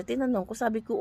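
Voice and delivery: ordinary talk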